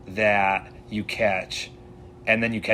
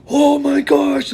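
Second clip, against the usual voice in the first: much higher, louder, drawn-out slowly; excited gasp; 9 dB louder, much slower than usual. Retold or imitated. Two friends seeing a giant trout in the headlamp, excited high squeal. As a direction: squeal it in amazement.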